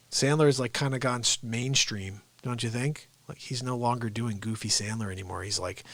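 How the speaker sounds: usual speaking voice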